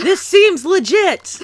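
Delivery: high, very slow cartoon voice; goofy high cartoon helper voice; cartoon DNA voice. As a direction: chime in as the chirpy cartoon helper. Joining the DNA cartoon bit.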